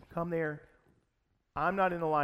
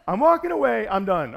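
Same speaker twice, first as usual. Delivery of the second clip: angry shout; 8 semitones higher, 13 dB louder than usual. Retold or imitated. Still shouting in the argument.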